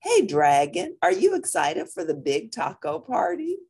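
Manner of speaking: ordinary delivery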